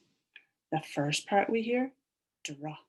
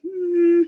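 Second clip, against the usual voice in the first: puzzled hum; 11 semitones higher, 12 dB louder than usual. Acted. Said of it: "Chunky Monkey's like".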